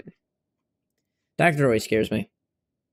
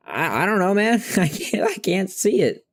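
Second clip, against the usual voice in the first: high, chuckling protest; higher voice, amused helplessness; helpless retold reply; 8 semitones higher than usual. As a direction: act out being clueless, pitched up with a little laugh. Acting his reply to barber.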